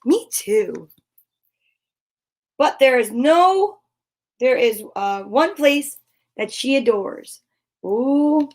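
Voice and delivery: usual speaking voice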